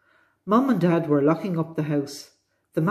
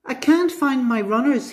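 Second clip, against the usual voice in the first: high-pitched, moderately raised shout; storybook shout; 9 semitones higher, 4 dB louder than usual. A narrator's reading. Danny claiming his runners are lost, shouted. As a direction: call out in a high acted boy's shout. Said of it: "he shouted".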